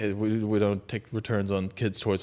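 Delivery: low, soft bored shopkeeper voice; pawn shop owner. Retold or imitated. Shopkeeper turning away the panicked customer, low and dismissive. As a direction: brush him off like a tired clerk.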